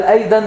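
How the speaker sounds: أيضًا with ض weakened to د; thin alef. Shown as a demonstration. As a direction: say أيضًا with light front vowels. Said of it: "الضاد مش هتنطق صح".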